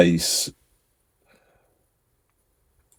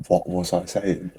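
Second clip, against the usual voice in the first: puzzled murmur; 8 dB quieter than usual. Retold or imitated. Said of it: "I was like".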